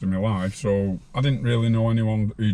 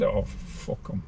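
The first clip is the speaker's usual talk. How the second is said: whispered; 4 semitones lower, 9 dB quieter, much slower than usual. Retold or imitated. Retold defiant reply.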